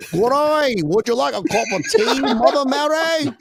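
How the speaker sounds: high, loud hammed-up British accent; bad British accent. Co-host showing off for laughing friends, hammed-up British accent. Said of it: "best British accent from a guy from Australia".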